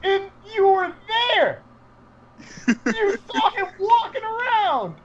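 high, pleading disbelief; a ranting fan, half laughing; rising outraged fan rant; incredulous rant. Real, not acted.

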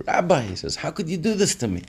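high-pitched mock-tearful plea; crying reproach. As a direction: wail it lightly, as a retold outburst. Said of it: "bursts out crying".

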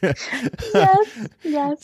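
high, eager agreement; excited yes. Real, not acted. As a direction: jump in delighted.

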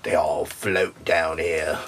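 low, slow mock monster; put-on creepy voice. Quoting a killer clown to friends, low mock-ominous drawl. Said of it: "I had that voice like that".

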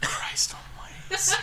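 breathy whispered exclamation. Real, not acted. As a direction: hush it under your breath. Whispered aside.

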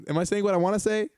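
high-pitched doubtful question; self-doubting. Acted. Host voicing a foreigner doubting her words, self-doubting. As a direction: question your own words, voice up. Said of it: "consistently doubting whether you're communicating".

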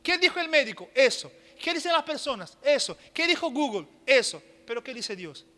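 call and answer. Acted. Preacher drilling the congregation with questions, loud call and answer. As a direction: fire questions, slam each answer.

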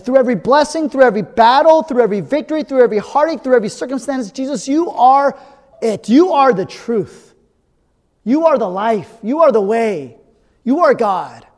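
preacher's crescendo, heavy on the repeated "through every"; preaching at full force. Acted. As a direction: build each line like a hallelujah. Builds to a preaching climax.

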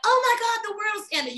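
imitating someone panicking when something bad happens; mock panic. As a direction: play the overreacting friend who thinks it's over. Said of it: "you're not gonna be like".

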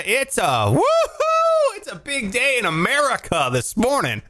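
big upbeat show opener; show host. Acted. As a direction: stretch the woohoo like a radio host. Show opening.

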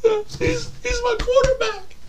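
very high, soft tearful whimper; Terrell Owens. Acted. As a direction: choke up in a tiny high voice. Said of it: "Terrell Owens".

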